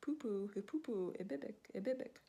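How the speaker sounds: sing-song hoopoe chirp; bird call. Acted. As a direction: chirp back cheekily. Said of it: "The bird says".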